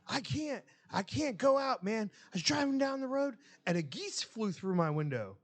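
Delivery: put-on high whiny dumb-kid voice; Morrow's excuse. Dumb employee whining his excuse to the boss. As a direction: squeak it out like a clueless kid. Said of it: "And he goes".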